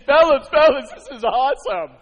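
loud, laughing, thrilled announcement; excited boast. Acted. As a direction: burst in bragging with a laugh. Joseph bursts in about dream.

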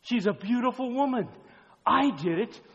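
loud, high, insistent pulpit voice; heated preaching. Acted. Preacher as David questioning Uriah about his wife, heated. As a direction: push each phrase out in acted disbelief.

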